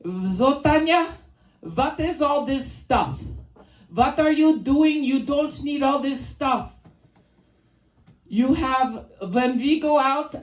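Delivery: put-on Hungarian dad accent. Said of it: "my dad would be like".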